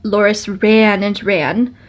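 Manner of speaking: ordinary delivery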